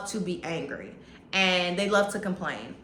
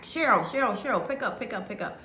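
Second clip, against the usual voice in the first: man on answering machine; 4 semitones higher, faster than usual. Retold or imitated. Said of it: "He's like".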